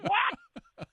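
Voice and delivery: high, loud yelp of disbelief; mock astonishment. Comedian retelling a lucky festival offer, high mock-astonished yelp. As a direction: blurt it out in squealing comic shock.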